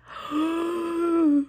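sharp gasp of delight. Real, not acted. Crafter spotting Christmas charms, gasping in delight. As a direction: gasp as if the charm steals your breath.

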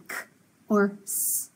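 bare "k", pause, hissed "s"; isolated letter sounds. Shown as a demonstration. Teacher showing two sounds of C, isolated sounds. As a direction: give "k" and "s" as sounds, not names. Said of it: "C can make two sounds".

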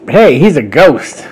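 loud, high, slowed story yell. Retold or imitated. Chris the baby spots that Fishing Boo is a ghost, yelling. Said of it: "Then Chris yells".

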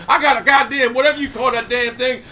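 normal talking voice

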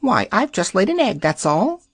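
high, crisp little hen voice; a matter-of-fact hen; small sharp hen voice. Acted. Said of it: "a small but sharp and distinct voice".

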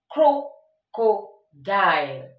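very slow "crocodile", each syllable drawn out. Shown as a demonstration. Teacher shows pupils how to pronounce a word, very slowly. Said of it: "how to pronounce the word".

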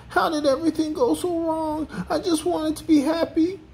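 very high, woeful character wail. A narrator's reading. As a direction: lament in a squeaky high voice. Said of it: "he wailed".

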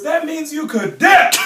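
high, loud, slow mock shout; mock yell. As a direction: belt the catchphrase like a battle cry. Friends quoting the brother's yell.

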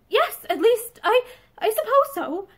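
high, faltering, close to tears; on the verge of tears. Acted. Wife near tears answering the man she loves, faltering. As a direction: hold back tears as you stammer. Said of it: "She was on the verge of tears".

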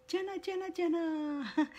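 high, soft sing-song call of a name. Real, not acted. Calling out to viewer Jenna.

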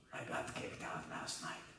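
low, breathless whisper down the phone; whispered low confession. Retold or imitated. Wild Man on the phone to Danny, low and whispered. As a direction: hush it like a secret.

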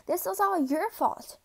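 angry shout. Acted. Cadet shouting at the superior, angry outburst. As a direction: shout the blame at them. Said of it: "you shouted at him".

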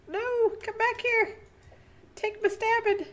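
excited yelling. Real, not acted. Chasing a fleeing fish in game.

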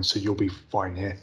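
ordinary talk, nothing marked

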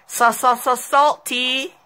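high teasing stutter; mocking sing-song. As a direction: taunt playfully. Mocking the opponent.

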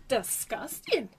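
slow, drawn-out mock British "Disgusting"; British accent impression. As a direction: ape the British lady saying "Disgusting" slowly. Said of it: "With that lady with the kids".

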